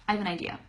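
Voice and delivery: "I have an idea" said fast, words blended together; shortened, linked. Shown as a demonstration. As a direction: slur "I have an idea" into one quick phrase. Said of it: "I said it a lot faster".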